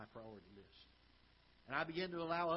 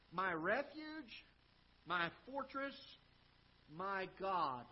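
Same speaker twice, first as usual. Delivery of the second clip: louder, higher preacher's voice, drawing out my refuge; emphatic preaching; 5 semitones higher, 6 dB louder than usual. Acted. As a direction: proclaim the verse to the congregation. Preacher reads the verse emphatically.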